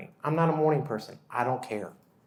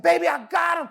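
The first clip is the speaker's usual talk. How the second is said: higher, louder victory call; triumphant shout; 8 dB louder than usual. Retold or imitated. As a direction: crow it out like a heroic dad. Dad triumphs over monster.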